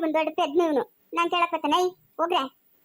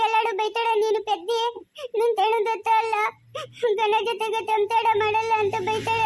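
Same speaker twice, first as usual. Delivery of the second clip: crying; 4 semitones higher than usual. Acted. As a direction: bawl out your grievance. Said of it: "ಅಳ್ಬಾರ್ದು, ಸುಮ್ನೆ ಇರು". (ಗೋಳೋ ಎಂದು ಅಳುತ್ತಾ ದೂರುವುದು)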